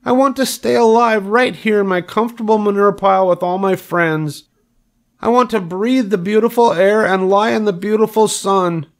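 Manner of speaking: very high, plaintive piglet; acted tearful wail. A narrator's reading. Wilbur pleads to stay alive with friends, tearful wail. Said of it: "Wilbur burst into tears".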